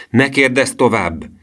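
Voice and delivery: high, slow, irate command; angry shout. A narrator's reading. Professor stopping the boys' questions, angry shout. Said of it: "kiáltotta dühösen a professzor".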